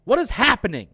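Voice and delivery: loud, high, fed-up yell; exasperated outburst. Retold or imitated. Watching the movie, yelling loud and high at the screen. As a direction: demand to know where the inbreds are. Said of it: "I'm like".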